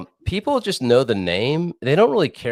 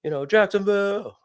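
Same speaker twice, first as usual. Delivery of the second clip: high-pitched imitation of a DJ's shout-out; DJ hyping crowd; 12 semitones higher than usual. DJ breaking off knob-twiddling to hail the crowd, mock hype call. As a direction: mimic a club DJ calling out the city. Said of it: "then every so often they're like".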